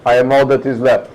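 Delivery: put-on grim captain with a light Russian accent. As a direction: read the doomed log in a slight Russian accent. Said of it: "talk in a Russian accent".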